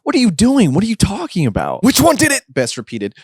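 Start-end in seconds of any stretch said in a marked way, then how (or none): 0.0-1.8 high, panicky questions; alarmed bewilderment. Acted. Damien protests as Best grabs him, alarmed. 1.8-2.4 high, urgent interrogation; frantic demand. Acted. Best grabs Damien's hands, frantically demanding.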